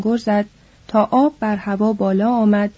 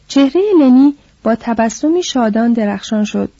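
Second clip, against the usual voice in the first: ordinary delivery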